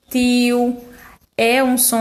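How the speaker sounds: ordinary talk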